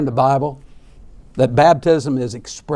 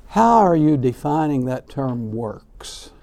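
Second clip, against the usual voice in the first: usual speaking voice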